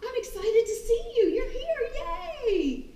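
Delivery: high excited dog voice; voicing the dog. Jumping dog greeting the trainer, high excited. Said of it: "The dog is like".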